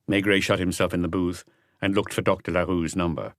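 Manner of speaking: plain reading voice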